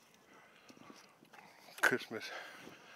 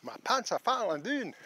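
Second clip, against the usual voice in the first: pitched up and louder, half laughing; high laughing alarm; 9 semitones higher, 5 dB louder than usual. Real, not acted. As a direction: call it out high in mock panic. Pants falling while walking.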